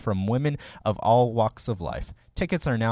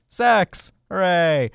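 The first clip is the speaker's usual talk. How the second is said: excited cheer; 6 semitones higher, 5 dB louder, much slower than usual. Acted. Mock cheer in announcement.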